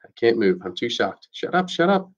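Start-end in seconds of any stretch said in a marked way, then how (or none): 1.3-2.1 frantic inner plea. A narrator's reading. Inner panic repeated.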